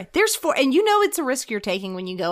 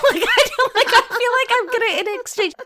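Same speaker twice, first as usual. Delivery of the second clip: high, loud laughing through the words; 12 semitones higher, 5 dB louder than usual. Real, not acted. Retelling the bagel story to her co-host, laughing high. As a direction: laugh while getting it out.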